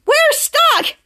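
very high-pitched mock alarm; alarmed group cry. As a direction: exclaim it high, like a trapped group. Group trapped in sticky string.

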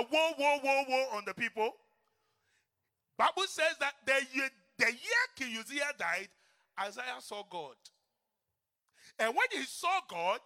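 loud, high preaching cry; full-force preaching. Acted. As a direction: call it out to the congregation.

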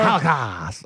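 nerd voice. Acted. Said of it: "the hacky nerd voice that we do".

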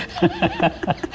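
a short, high chuckle; amused laugh. Real, not acted. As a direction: laugh off being turned away. Laughs after retold rejection.

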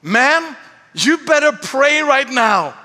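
high, slow, insistent order; drunk passenger's demand. Retold or imitated. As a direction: imitate a drunk man demanding, drawn out. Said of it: "and then he says".

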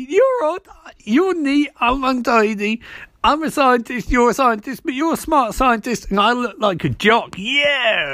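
high, eager dopey jock voice; goofy high voice. Retold or imitated. Wrongy pitching a team-up to Martin, goofy and high. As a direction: pitch it up like an excited himbo. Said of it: "And you're like".

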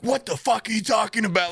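high, mock-furious phone argument; pretend angry phone rant. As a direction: act fed up with someone on the phone. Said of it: "looks like I'm angry on the phone".

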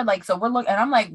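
usual speaking voice

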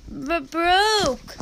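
acted sobs, high and halting; little boy crying over his truck; sobbing. A narrator's reading. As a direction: stammer through tears. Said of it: "Naphtali said between sobs".

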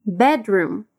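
Bedroom said slowly, the D and R not fully joined; careful bedroom. Shown as a demonstration. Teacher modelling Bedroom, D and R kept apart. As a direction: give Bedroom a light D before the R, not merged. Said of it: "Cuidado com a pronúncia de bedroom".

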